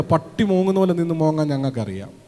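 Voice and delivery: usual speaking voice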